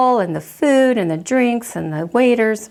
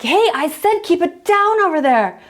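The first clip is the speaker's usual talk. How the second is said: higher, sharper yell, losing patience; irritated repeat yell; 8 semitones higher than usual. Retold or imitated. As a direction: call over the hedge with a warning edge. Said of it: "and you're like".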